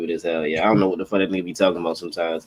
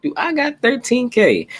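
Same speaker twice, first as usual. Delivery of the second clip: slow, high-pitched questioning of oneself; reenacted high, incredulous self-check; retold doubt. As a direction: pitch it way up and ask it slowly. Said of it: "I was just like".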